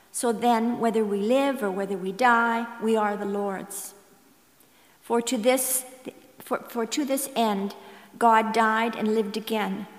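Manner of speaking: usual speaking voice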